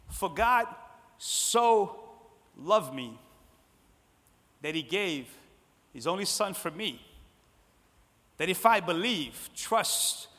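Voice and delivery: slow, broken into weighty short phrases; deliberate verse recitation. Acted. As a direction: read it as a personal confession, pausing often.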